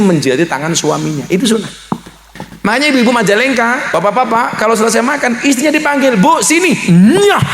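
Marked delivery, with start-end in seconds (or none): 6.2-7.4 high, louder teasing call to the wife; playful call. Acted. Husband playfully calling his wife after the meal, high and loud. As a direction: call her over, then a playful smack.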